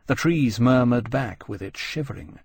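ordinary delivery, nothing marked

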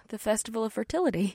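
fading, hushed musing; trailing off. Acted. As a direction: let it trail away. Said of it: "Batari trailed off".

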